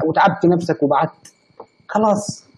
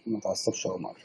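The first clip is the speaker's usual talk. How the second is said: whisper; 7 semitones lower, 16 dB quieter than usual. Real, not acted. Whispered calming to viewer.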